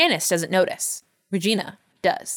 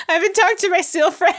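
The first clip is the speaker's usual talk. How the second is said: high, giggling delivery; laughing; 11 semitones higher than usual. Real, not acted. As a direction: laugh through the line. Laughing joke.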